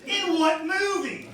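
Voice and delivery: high, loud, drawn-out shout; stretched-out holler of disbelief; incredulous yell. Retold or imitated. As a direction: imitate a baffled heckler yelling slowly. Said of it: "a different guy yelled".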